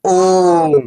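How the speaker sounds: ओम with a long, sustained ओ; slow, stretched-out ओम; drawn-out vowel. Shown as a demonstration. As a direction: hold the vowel of ओम before closing on the म. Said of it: "ओम तुरंत बोलेंगे क्या?".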